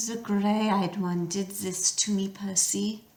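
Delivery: soft, slow coaxing monster voice; Medusa, softly luring. A narrator's reading. Medusa luring Percy with a soft voice. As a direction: coax him to look up, gently.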